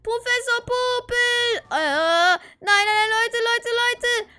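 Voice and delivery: loud, high panicked pleading. Acted. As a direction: yell in terror. Fleeing the reaper in panic.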